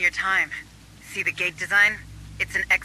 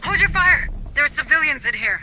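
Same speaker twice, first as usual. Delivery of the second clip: higher, louder order to stop firing; urgent command; 7 semitones higher, 4 dB louder than usual. Acted. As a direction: shout to stop the shooting. Stops squad from shooting civilians.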